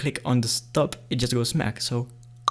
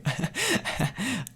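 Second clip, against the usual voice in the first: high amused giggle; 9 semitones higher, 5 dB quieter than usual. Real, not acted. Laughing at crunchy apple trick.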